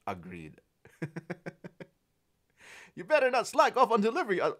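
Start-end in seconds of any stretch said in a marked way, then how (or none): 3.0-4.6 laughing. Real, not acted. Playful warning while laughing.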